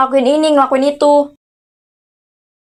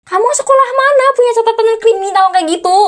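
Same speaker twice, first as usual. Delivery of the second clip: indignant; 9 semitones higher than usual. Acted. Aunt scolding, pitch jumps.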